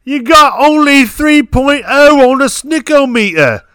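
put-on loud, high British accent; British bloke, hammed up loud and high. British mate mocking the snickometer, loud high British accent. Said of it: "how do you think the gentleman who used Tutter would talk about the snickometer".

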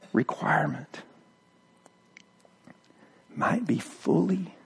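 soft, low, near whisper with long pauses; hushed scripture reading. Acted. Preacher reading Romans softly to the church: hushed. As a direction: read it like a prayer in the dark.

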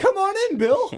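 high, sly mock Heyman beckoning; creepy invitation. Joking about Heyman luring Goldberg, sly high invitation. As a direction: lure him in with a sly high voice. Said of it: "and he was like".

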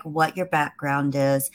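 ordinary talk, nothing marked

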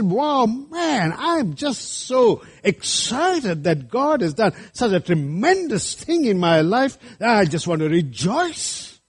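high-pitched, drawn-out acted excitement; overjoyed exclamation. Preacher voicing a thrilled believer to the congregation, excited. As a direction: gush like a believer bursting with wonder.

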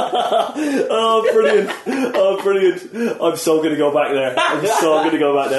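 loud, high, laughing glee; delighted laughter. Real, not acted. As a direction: crow it through real laughter.

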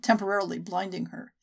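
ordinary delivery

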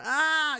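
high drawn-out "ah" of giving in; mock submissive wail. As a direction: roll over with a high, helpless wail. Acting a dog rolling over.

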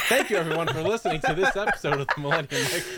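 laughing through the sign-off; laughing outro. Real, not acted. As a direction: announce while cracking up.

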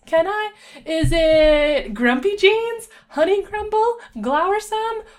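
very high, loud, bubbly guessing; relentless squeaky-bright cartoon enthusiasm; hyper pony. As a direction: rattle off guesses like an overexcited game. Hyper pony plays guessing game.

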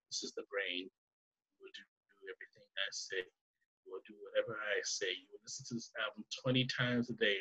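low, soft droning voice; subliminal message. Retold or imitated. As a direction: murmur it like a hypnotic message. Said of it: "subliminal message".

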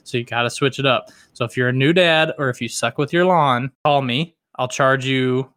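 ordinary talk

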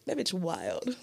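soft, dry, under the breath; quiet deadpan quote. Retold or imitated. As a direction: say it flat and quiet. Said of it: "they'd be like".

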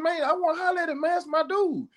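retelling asking for a friend's number, excited; eager retold line. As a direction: pitch way up, keen to reconnect. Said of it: "I said".

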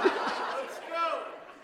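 high breathy burst of laughter; amused laugh. Real, not acted. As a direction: laugh out at his own joke. Preacher laughing.

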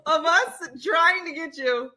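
high, loud, laughing wail; exasperated, cracking up as you protest; laughing exasperation. Real, not acted. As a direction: squeal it slowly through helpless laughter. Exasperated, laughing at his mispronunciation.

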